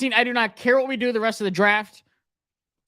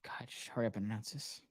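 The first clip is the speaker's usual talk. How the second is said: anxious mutter; 10 semitones lower, 19 dB quieter, faster than usual. Real, not acted. Waiting anxiously for the pick.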